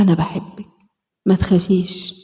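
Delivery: gentle lullaby-like comfort; tender, soft reassurance. Acted. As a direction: soothe a scared little girl. Comforting the inner child.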